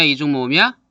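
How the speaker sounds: usual speaking voice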